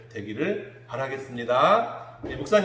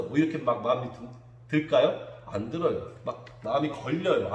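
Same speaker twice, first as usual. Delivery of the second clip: normal talking voice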